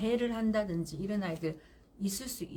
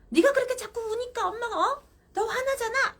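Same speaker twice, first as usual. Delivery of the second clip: much higher and louder, exasperated; emotional angry mother; 13 semitones higher, 6 dB louder than usual. Shown as a demonstration. Mother losing her temper at her crying child, angry and much higher. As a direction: let the anger spill out shrilly. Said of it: "이렇게 하시면 감정을 쓴 거잖아요".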